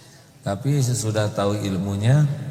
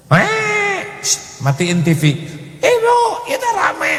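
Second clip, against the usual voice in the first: put-on child shouting.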